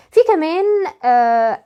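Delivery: ordinary talk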